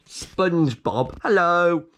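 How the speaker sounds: cheery hello. Pretend. Greeting cartoon character.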